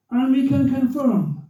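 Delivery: high, slow announcer drawl; radio commentator. Retold or imitated. Commentator confirming the result, imitated slow announcer. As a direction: stretch it out like a commentator. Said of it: "He's the radio commentator".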